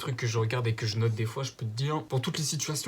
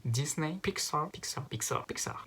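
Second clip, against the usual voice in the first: "Pixar" said the English way, higher and softer; English accent; 4 semitones higher, 4 dB quieter than usual. Shown as a demonstration. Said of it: "avec l'accent anglais". (accent anglais sur "Pixar")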